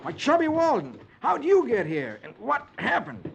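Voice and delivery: a cowboy finding his friend hurt; exclaiming. Acted. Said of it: "he exclaims".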